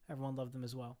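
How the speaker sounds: ordinary talk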